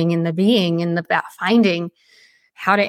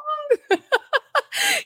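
high laugh. Real, not acted. Laughing at her own doubt.

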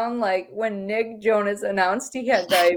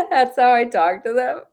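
ordinary talk, nothing marked

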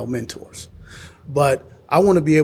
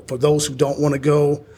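normal talking voice

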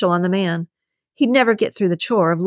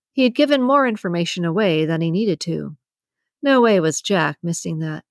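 ordinary delivery, nothing marked

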